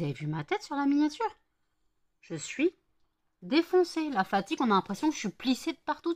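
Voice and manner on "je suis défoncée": plus aigu et plus fort; exclamation exagérée. Real, not acted. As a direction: force le mot avec emphase. Exagère sa fatigue. (emphase exagérée)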